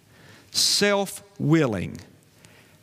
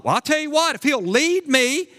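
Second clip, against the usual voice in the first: mock reluctant wife; 9 semitones higher than usual. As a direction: play the wife haggling over conditions. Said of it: "not like".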